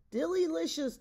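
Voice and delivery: high, loud sing-song rave; raving delight. Acted. Ogres at the feast drumming spoons, raving delight. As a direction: cheer the dessert like a chant. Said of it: "raved the ogres".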